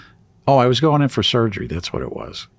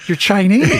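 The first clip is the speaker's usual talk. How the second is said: high, louder, cracking up; retold hysterical laughter; 11 semitones higher, 3 dB louder than usual. Patient tells the swab technician what's funny, retold cracking up. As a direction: blurt it out high and burst into laughing. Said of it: "I start laughing hysterically".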